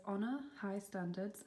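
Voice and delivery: ordinary talk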